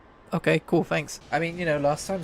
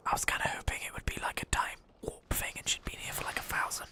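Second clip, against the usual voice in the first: hushed, breathless aside; whispering; 11 dB quieter than usual. Real, not acted. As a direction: whisper it to yourself.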